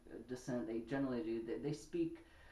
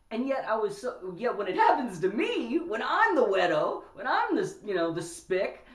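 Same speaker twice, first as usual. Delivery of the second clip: much higher and louder, building outrage; heated indignation; 10 semitones higher, 11 dB louder than usual. Real, not acted. Telling listeners how the slur hit him, heated and loud. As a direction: let the injustice pour out. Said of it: "outraged about the slur".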